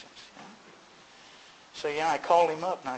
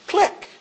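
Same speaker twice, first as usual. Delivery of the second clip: phone click; 8 dB louder than usual. Retold or imitated. Imitates phone hanging up.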